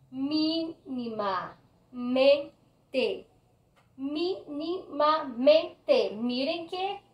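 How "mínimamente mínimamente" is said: Mínimamente very slowly, mí-ni-ma-men-te; syllable by syllable. Shown as a demonstration. Teacher demonstrating sobresdrújulas, Mínimamente slowly in separate syllables. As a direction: separate every syllable of Mínimamente, pausing between. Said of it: "esta es la palabra tónica".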